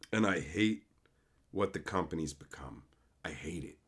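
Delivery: a real, breathless whispered admission; bitter whisper. Real, not acted. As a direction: let it fall to a near-silent whisper. Bitter confession.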